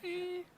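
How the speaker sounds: high, thin "whee" squeal; imitated gleeful whee. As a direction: mimic the thrill of sliding with a high squeal. Voicing the thrill of a handbrake drift.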